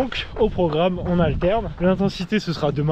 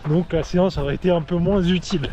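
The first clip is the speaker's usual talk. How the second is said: ordinary talk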